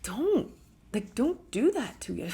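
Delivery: high, pleading don't; mock pleading protest; exasperated plea. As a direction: beg them to stop piling on. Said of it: "it's like".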